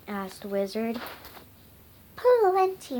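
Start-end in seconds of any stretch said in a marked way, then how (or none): 2.1-3.0 sharp, high and loud retort; snapped. A narrator's reading. Marigold answering what's the matter, snapped. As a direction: snap it back. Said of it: "Marigold snapped".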